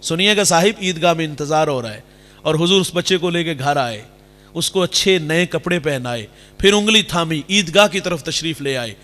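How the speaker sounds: ordinary delivery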